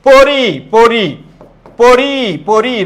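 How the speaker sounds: "পরি" stretched as po-riii; drawn-out syllables. Shown as a demonstration. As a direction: say "পরি" very slowly, holding the final i. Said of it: "রয়ের পরে কি?".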